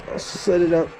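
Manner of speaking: ordinary talk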